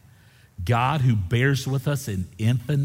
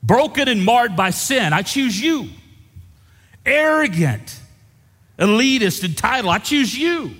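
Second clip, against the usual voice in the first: high, loud revival preaching; preaching at full force; 8 semitones higher, 5 dB louder than usual. Acted. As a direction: shout it out to the whole church. Preaching God's choosing at full force.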